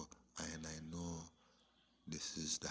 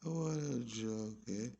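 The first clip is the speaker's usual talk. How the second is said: laughing; 10 semitones higher, 7 dB louder than usual. Real, not acted. Laughing at an old memory.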